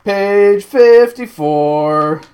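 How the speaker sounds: loud, high, very slow sing-out; drawn-out announcement. Pretend. Reader finding the page, loud drawn-out call. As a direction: stretch each word out loudly. Drags out page number theatrically.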